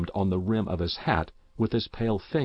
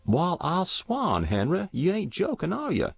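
startled rustic farmer; 9 semitones higher than usual. A narrator's reading.

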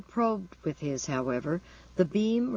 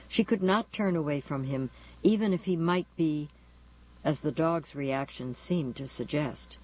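ordinary delivery, nothing marked

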